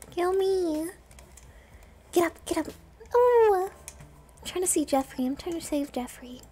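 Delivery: normal talking voice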